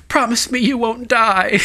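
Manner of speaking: high pleading plea; pleading girl. Retold or imitated. Host retelling the scene, mock pleading girl.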